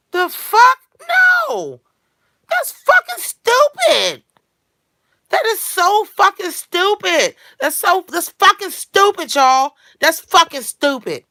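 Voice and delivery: high, loud, drawn-out shouts; exasperated full-voice outburst; outraged yelling. Real, not acted. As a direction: yell it with every word stretched and punched. Outraged rejection in a rant.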